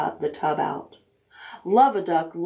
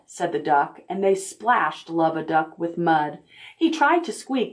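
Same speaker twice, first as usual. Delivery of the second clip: usual speaking voice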